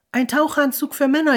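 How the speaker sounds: high, loud cry of delight; jubilant. A narrator's reading. Child cheering over the unwrapped present, high and loud. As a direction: squeal with joy at the present. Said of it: "jubelte Bar".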